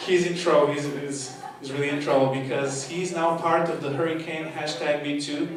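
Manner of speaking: ordinary delivery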